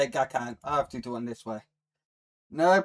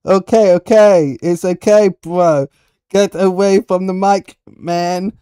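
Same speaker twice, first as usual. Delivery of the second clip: loud, higher-pitched telling-off; loud protest; 4 semitones higher, 15 dB louder than usual. Real, not acted. Streamer telling someone to get away from the mic, loud protest. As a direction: raise your voice sharply to shoo them off.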